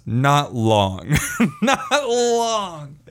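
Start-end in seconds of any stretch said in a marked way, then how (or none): 1.4-3.0 high, drawn-out laughing repeat; high pitch, laughter running into the words. Real, not acted. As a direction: crack up while repeating the punchline.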